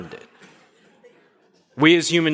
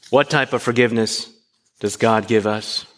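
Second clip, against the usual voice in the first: ordinary delivery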